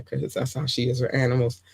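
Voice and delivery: usual speaking voice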